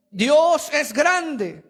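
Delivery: "Dios es grande" stretched very slowly and a little loud; slow proclamation. Acted. Preacher voicing Ezra proclaiming God slowly.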